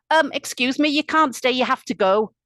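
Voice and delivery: high, loud irritated interruption; annoyed. A narrator's reading. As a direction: butt in insistently, annoyed. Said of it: "really annoyed".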